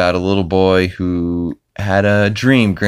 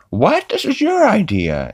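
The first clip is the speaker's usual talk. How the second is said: Chris voice. Retold or imitated. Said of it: "he's like".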